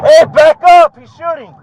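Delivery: loud urgent command; shouting. Real, not acted. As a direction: bark the warning. Officer yelling under fire.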